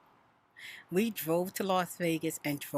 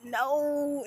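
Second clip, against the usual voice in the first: anguished groan; 10 semitones higher, much slower than usual. Retold or imitated. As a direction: groan as it hits the animal. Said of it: "I was like".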